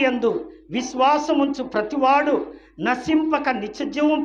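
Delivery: a fervent sermon proclamation of the verse; proclaiming scripture. Acted. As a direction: declare the verse to the whole church. John 3:16 proclaimed.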